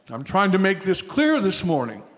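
ordinary talk, nothing marked

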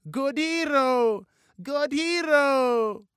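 put-on high, slow, loud toddler chant; drawn-out baby cheer; babbling baby. A narrator's reading. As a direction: repeat it like a proud little child. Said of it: "Baba made her repeat it over and over".

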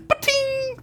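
high-pitched "ba-ting" sound effect; bullet ricochet. Acted. Narrator voices a bullet bouncing off gold, high ringing ricochet.

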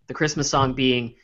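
ordinary delivery

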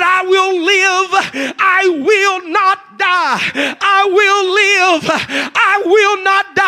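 slow, loud prophetic declaration; shouted declaration. Retold or imitated. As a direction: proclaim each word slowly at full force. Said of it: "I started prophesying to myself".

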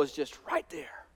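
breathy stage whisper of temptation. Shown as a demonstration. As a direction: whisper it like evil at your ear. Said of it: "just whispering in my ear".

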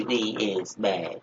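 slow, strange alien voice. Pretend. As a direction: drag it out like an alien. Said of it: "like E T".

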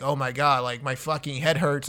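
ordinary delivery

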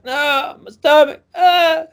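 very high, loud mock whine; wailing little boy. Boy refuses to go home, high hammed-up wail. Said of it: "saying his stomach hurts".

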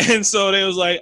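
ordinary delivery

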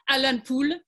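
"Alan Poole" with a French accent, stress on "Poole". Shown as a demonstration. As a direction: say "Alan" the French way, rising at the end. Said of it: "I'm gonna say it in a French accent".

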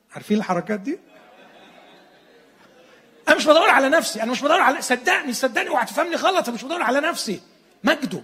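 two hotheads arguing, hammed up; mock heated squabble; acted argument. Disciples arguing, acted quarrel.

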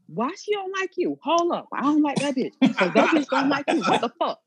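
high-pitched mock outrage; indignant on friend's behalf. Friend acting out loyal outrage for laughs, indignant.

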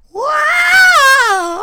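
a high, loud cry on one word; theme song ad-lib shout. Retold or imitated. Belting the theme song's ad-lib after the title line.

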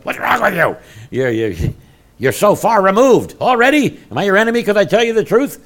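high, loud, scolding rebuke; chastising scold. Retold or imitated. Paul rebuking the Galatians, high and loud. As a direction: rebuke them like an exasperated apostle. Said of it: "chastising them and he said".

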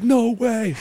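high-pitched excited mock disbelief; imitating freaking-out girl. Little girl freaking out over scooped money, imitated excitement. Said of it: "this girl's freaking out".

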